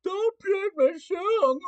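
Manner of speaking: high, slow whiny crybaby voice; mock the complainers with a drawn-out baby whine; mock crying baby. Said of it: "all you crying babies out there going".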